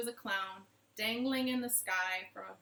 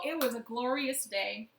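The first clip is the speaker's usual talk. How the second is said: ordinary delivery, nothing marked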